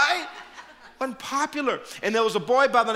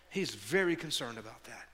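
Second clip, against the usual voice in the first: ordinary delivery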